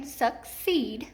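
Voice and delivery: "succeed" split, long E held on "ceed"; stressed second syllable. Shown as a demonstration. Teacher testing with hand under chin, "succeed" stressed on the second syllable. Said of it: "this is accented in the second syllable".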